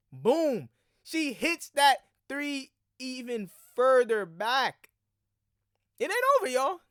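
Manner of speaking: loud, high hype call; excited shout. Real, not acted. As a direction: shout it out like the shot just dropped. Hyping a deep three.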